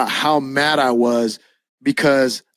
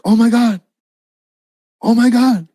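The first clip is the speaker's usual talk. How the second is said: high, louder mock cry of amazement; pitched-up, overwhelmed exclamation; retold tearful astonishment; 8 semitones higher, 5 dB louder than usual. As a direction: imitate a woman overcome. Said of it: "she starts crying".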